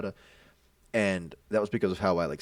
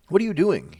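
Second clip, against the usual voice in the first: loud, raised-pitch challenge; retold angry demand; confronting; 9 semitones higher, 9 dB louder than usual. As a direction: call him out sharply. Acted confrontation of the other kid.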